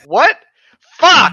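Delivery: loud startled yelp that breaks into a laugh; shocked outburst. Real, not acted. To co-host who already started the story, a loud startled yelp. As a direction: caught off guard, blurt it out loud.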